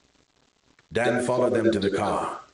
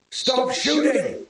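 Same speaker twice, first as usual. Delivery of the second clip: much higher, slow bellow; 3 dB louder, slower than usual. A narrator's reading. Man pinned behind a car bellows at gunmen to stop. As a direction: roar it out to make them stop. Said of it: "he bellowed".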